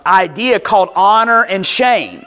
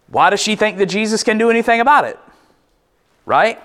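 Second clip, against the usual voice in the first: ordinary talk, nothing marked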